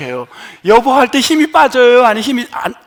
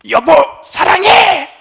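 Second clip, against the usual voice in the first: 여보, 사랑해 yelled loud and high; shouted love; 6 semitones higher, 4 dB louder, slower than usual. Shown as a demonstration. Pastor mocking a spouse shouting 여보 사랑해 at home, loud. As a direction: bark the love out with force. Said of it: "뭐 이런 사람 없잖아요".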